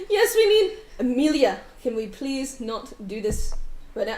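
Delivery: brusque man pushing the strangers away; sharp, loud and curt; impatient man. Acted. GM voicing the husband, wary of strangers, curt and loud.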